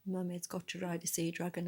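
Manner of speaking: usual speaking voice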